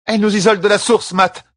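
high, loud urgent call to Matt; alarmed shout. A narrator's reading. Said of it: "dit Jolene".

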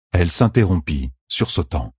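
ordinary delivery, nothing marked

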